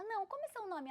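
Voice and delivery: high, insistent question; somewhat nervous. Retold or imitated. Girl insisting on the stranger's name, nervous and high. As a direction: press for an answer, pitch jumping up. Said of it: "Ela tava meio nervosa".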